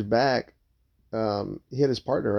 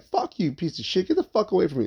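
mock-angry telling-off, pitch raised; high-pitched demo of real hostility; 8 semitones higher than usual. Shown as a demonstration. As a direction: act out a hostile brush-off without shouting. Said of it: "angry tone of".

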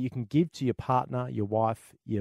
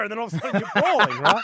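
excited; 6 semitones higher, 5 dB louder than usual. Real, not acted. Excited bar fight retelling.

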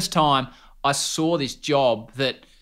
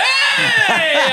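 high, loud startled yell; shout; 6 dB louder than usual. Retold or imitated. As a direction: yell out in surprise. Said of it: "far shout".

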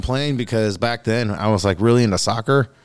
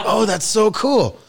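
mock delight; 8 semitones higher, 3 dB louder, slower than usual. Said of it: "I was like".

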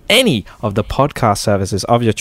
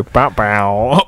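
imitated celebration noise. Contestant teasing the host over his celebration, mimicked fanfare. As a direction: mimic a spoken victory jingle, stretched out. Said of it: "Why did you go".

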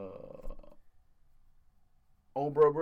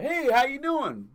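high, loud, slow door greeter hello; loiterer greeting; 12 semitones higher, 8 dB louder, slower than usual. Retold or imitated. Man mimics the loiterer greeting him at the door, high and slow. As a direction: stretch out a cheerful fake-employee welcome.

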